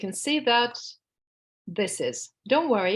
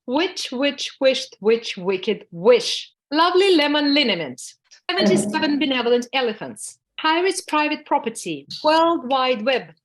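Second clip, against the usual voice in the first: high tongue twisters; 5 semitones higher, 5 dB louder than usual. Shown as a demonstration. Teacher warming up the class voice, high and loud with falls. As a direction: start high and loud, each phrase falling. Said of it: "we have to start higher".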